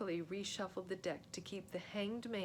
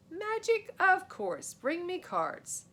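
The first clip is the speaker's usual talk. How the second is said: much higher, louder, slow grand stage voice; showman magician; 11 semitones higher, 9 dB louder, slower than usual. Acted. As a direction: play the big-time magician to the court. Boy posing as stage magician.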